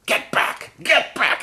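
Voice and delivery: high, loud shriek of get back; imitating a shrieking woman. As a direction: shriek like a crazed neighbor yanking her dog. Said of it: "she starts".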